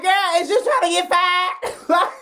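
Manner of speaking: high, slow, incredulous clapback; outraged sass. Retold or imitated. Customer scolding the rude bartender, high and indignant. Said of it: "I was like".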